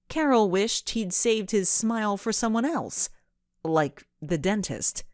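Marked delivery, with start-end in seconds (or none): none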